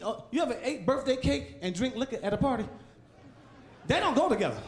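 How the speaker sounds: ordinary talk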